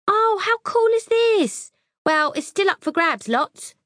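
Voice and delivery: very high, loud, gushing recognition; gushing airhead voice. A narrator's reading. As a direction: squeal like an excited ditz. Debs gushing.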